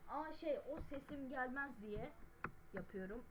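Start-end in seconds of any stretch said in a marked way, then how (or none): none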